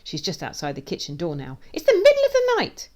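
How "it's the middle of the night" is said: very high, loud complaint; exasperated shout. A narrator's reading. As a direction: holler it at the dog from the next room. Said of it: "shouts Snookums".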